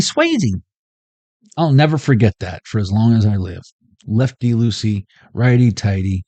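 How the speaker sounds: normal talking voice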